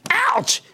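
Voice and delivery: sudden high yelp of pain; sharp ouch as the set cuts him; real pained yelp. Real, not acted. Host cuts his arm on the set, real pained yelp.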